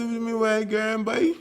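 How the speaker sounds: high, quick laughing answer; laughing reply. Retold or imitated. [name] answering the shocked man, laughing. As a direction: toss it back with a laugh. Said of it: "I said".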